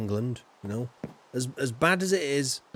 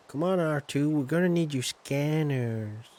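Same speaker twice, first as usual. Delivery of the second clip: a hint of Luke asking his droid for help; Luke Skywalker. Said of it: "That's my Luke Skywalker impression".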